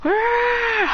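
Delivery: high, held mock battle cry; sustained high "ah" of effort; power-up cry. Acts a fighter powering up.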